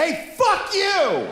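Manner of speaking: high, drawn-out road-rage yell; mock yell. Yelling out the car window at a pickup driver, mock yell. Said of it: "I yelled out my window".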